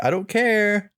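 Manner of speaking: high, careless throwaway; mock high careless voice. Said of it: "he's literally just like".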